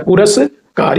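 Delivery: ordinary talk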